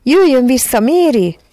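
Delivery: high, loud pleading call. A narrator's reading. Children beg Mary to fly back, pleading shout. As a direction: call someone back from far away. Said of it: "kiáltották".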